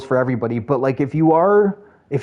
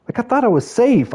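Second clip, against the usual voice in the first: whiny protest; 10 semitones higher than usual. Retold or imitated. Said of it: "I'm like".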